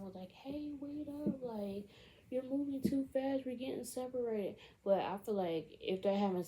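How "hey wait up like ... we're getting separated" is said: high, stretched call at ordinary volume; mock calling out. Girlfriend falling behind on the bridge, mock calling out. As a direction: imitate a worried girlfriend calling ahead. Girlfriend calls after him, retold.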